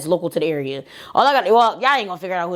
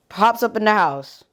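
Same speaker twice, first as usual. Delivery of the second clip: usual speaking voice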